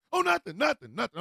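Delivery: high, quick innocent protest; hasty denial. Acted. Roommate denying he used the phone, hasty denial. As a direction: brush it off a little too eagerly.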